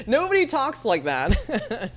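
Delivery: laughing while talking. Real, not acted. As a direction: giggle through the words. Laughing at own impression.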